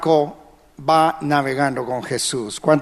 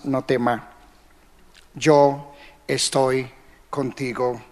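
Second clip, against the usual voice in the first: put-on soft and low, like a father holding a scared child; padre tranquilizador; 4 semitones lower, 4 dB quieter than usual. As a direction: hush him gently, slow on estoy. Padre calma a hijo ahogándose. (tierno y suave, más grave y bajito)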